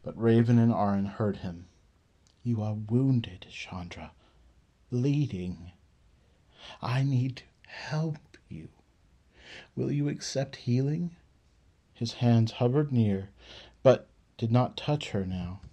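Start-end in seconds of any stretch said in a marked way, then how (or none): 2.4-11.1 soft, slow, gentle murmur; whispered. Acted. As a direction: ease it to the wounded woman softly. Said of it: "Alabar whispered to her".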